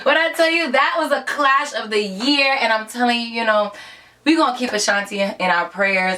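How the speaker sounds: ordinary delivery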